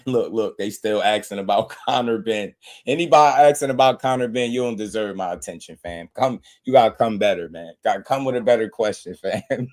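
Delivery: normal talking voice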